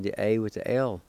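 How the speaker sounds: normal talking voice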